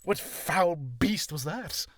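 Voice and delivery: slow, drawn-out theatrical question; mock-epic exclamation. Streamer reacts to a foul interruption, mock-epic exclamation. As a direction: declaim it slowly, like a fantasy hero.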